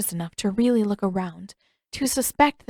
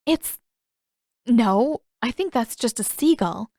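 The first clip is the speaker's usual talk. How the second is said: flustered; 3 semitones higher than usual. Acted. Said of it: "when she found her voice again".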